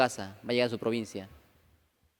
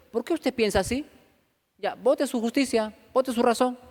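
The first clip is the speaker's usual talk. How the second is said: higher-pitched stern scolding; exhortación severa; 9 semitones higher than usual. Acted. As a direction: exhort them firmly, pitched up. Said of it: "le gusta a usted que le exhorten". (exhortación firme en tono alto)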